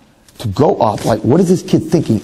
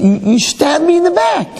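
high, hurt whine; mock betrayal. Rabbi acting out his hurt at the student, high whine. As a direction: play it wounded and dramatic. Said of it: "I was like".

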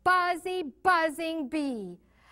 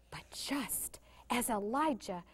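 whisper; 4 semitones lower, 8 dB quieter than usual. A narrator's reading. Hushed suspense in story.

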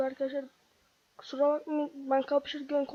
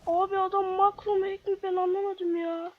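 panicked, near tears; 5 semitones higher, 5 dB louder than usual. Real, not acted. Panicking against a hacker.

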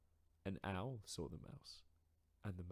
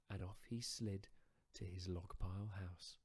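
usual speaking voice

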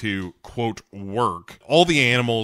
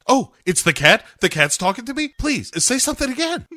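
high-pitched, hammed-up disbelief; overacted girl hearing voices; frantic girl; 12 semitones higher, 4 dB louder, faster than usual. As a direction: plead high and excitedly, in mock desperation. Acting out frantic girl hearing cat.

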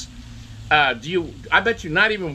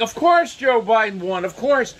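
mock confident press; 8 semitones higher than usual. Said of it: "press was so supremely confident".

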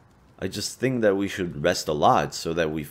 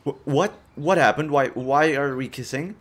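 higher, stammering bewilderment; startled confusion; 6 semitones higher, 4 dB louder than usual. Acted. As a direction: blurt it out higher and louder, completely thrown. Comes to mid-kiss, bewildered.

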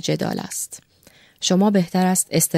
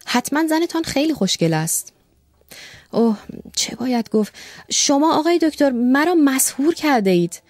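put-on higher, eager voice of a young man in love; smitten young secretary; 7 semitones higher than usual. Young secretary gushes to the doctor about his beloved wife. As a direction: gush like a bashful boy showing off his toys.

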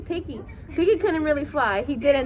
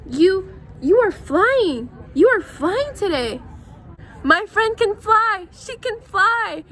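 slow, higher, louder amazement; amazed Elephant; 5 semitones higher, 5 dB louder, much slower than usual. Acted. Elephant marvels that Piggy is flying, slow and amazed. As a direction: marvel slowly at what you see.

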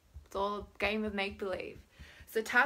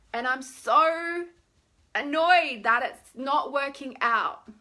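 high, loud, drawn-out "so"; slow, whiny annoyed complaint; acted annoyance; 3 semitones higher, 8 dB louder, much slower than usual. Shown as a demonstration. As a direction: vent it with heavy exaggeration.

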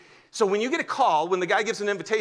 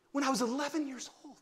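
ordinary talk

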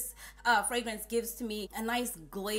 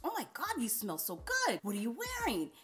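delighted compliment; 2 semitones higher, 3 dB quieter than usual. Retold or imitated. Director passing by, swooning over the scent, pitched up. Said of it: "she goes".

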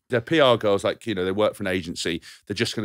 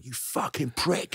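slow, higher, hushed mock fury; retold angry cursing; 5 semitones higher, 7 dB quieter, slower than usual. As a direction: curse him drawn out under your voice. Retold angry phone call.

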